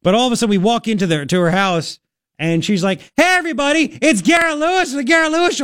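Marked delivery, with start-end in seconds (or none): 3.2-5.6 very high mock announcement to a room; imitated gushing neighbor. Neighbor introducing radio host at kid's birthday, high mock excitement. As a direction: mimic a host showing off a guest.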